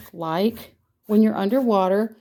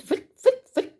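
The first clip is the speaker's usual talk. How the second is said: high quick foot sounds; foot noises. Shown as a demonstration. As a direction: puff out each brush stroke. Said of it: "you hear the foot, foot".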